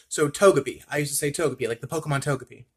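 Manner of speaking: ordinary talk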